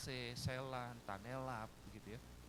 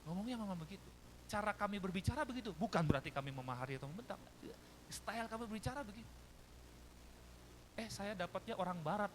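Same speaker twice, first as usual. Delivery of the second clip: normal talking voice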